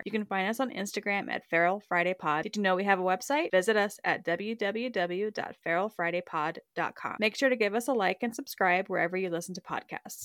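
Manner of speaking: soft, steady podcast sign-off read; outro read. Acted.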